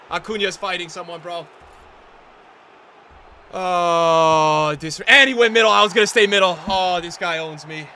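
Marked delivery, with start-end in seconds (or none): 3.5-4.8 pained groan. Real, not acted. Groan at conceding.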